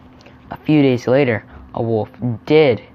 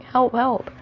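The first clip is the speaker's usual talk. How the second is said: scared cry; 11 semitones higher than usual. Acted. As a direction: imitate a frightened boy, pitch way up. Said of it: "He's actually really scared there".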